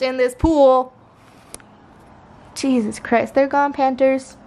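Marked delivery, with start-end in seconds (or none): none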